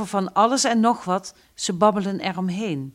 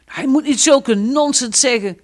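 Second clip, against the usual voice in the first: angry retort; 6 semitones higher, 7 dB louder than usual. Acted. Frans angrily retorts.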